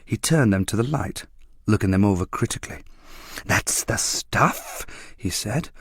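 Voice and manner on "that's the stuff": hushed approval. A narrator's reading. Dealer appraising junk.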